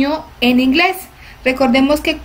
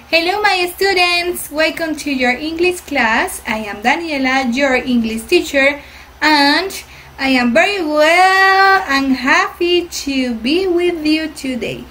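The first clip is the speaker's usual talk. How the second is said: put-on bright, slow teacher welcome to children; cheerful class greeting; 2 semitones higher, slower than usual. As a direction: greet the little students warmly. (saludo de maestra a niños)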